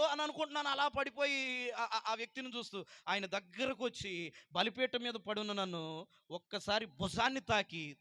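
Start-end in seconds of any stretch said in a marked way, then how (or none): none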